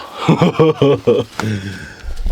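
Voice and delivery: real nervous laugh after a fright; startled laugh. Real, not acted. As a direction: laugh off the sudden scare. Bat just flew around him.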